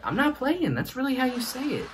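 squeaky-high defensive grandchild; high-pitched protest. Acted. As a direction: insist in a very high voice. Acted protest to doubting grandfather.

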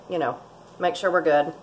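ordinary delivery

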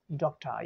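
"डॉक्टर" as DOK-tuh, R dropped; schwa ending. Shown as a demonstration. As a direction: teach "डॉक्टर" with a falling, open uh ending. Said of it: "ये मैं बहुत slow बोल रहा हूँ".

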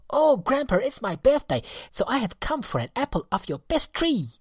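put-on very high, loud excited child; merry child. Elsie calling to her grandfather, merry and very high. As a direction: bounce like a birthday girl. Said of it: "a merry childish voice".

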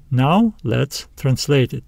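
normal talking voice

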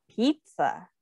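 ordinary talk

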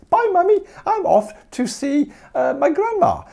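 put-on high, loud, sing-song child's voice; little girl voice. Teacher voicing the little girl saying goodbye, high and childish. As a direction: pipe it up like a small girl. Girl's voice in retold story.

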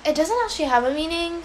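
real slight Filipino accent, pitch swooping up. Real, not acted. Streamer answering a viewer's question about a name, Filipino accent slipping out. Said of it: "it sounded so Filipino".

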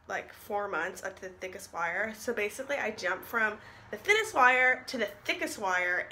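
jaw held by elastics; talking with braces rubber bands. Real, not acted. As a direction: stiff restricted mouth opening. Seen 1: Braces visible on teeth throughout; inter-jaw bands likely, hard to see.

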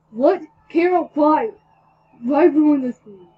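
loud, high outraged cry; outraged yell at Kira; shocked shout. Acted. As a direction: yell at her in dismay. Shocked confrontation in story.

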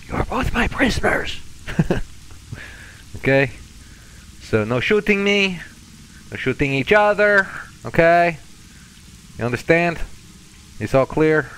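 high-pitched mock-Russian ordering prisoners about; fake-Russian captor. Telling both prisoners no shooting, high fake-Russian captor. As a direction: lay down rules as a hammed-up Russian captor. Lays down rules to prisoners in character.

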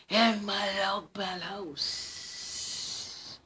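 slow, hissing snake voice. Acted. Snake lures the mouse to a feast. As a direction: draw it out like a snake.

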